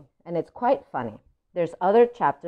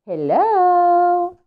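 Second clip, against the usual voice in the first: high, bright little duckling hello; tiny duckling voice; 12 semitones higher, 7 dB louder than usual. Acted. Duckling greets in story.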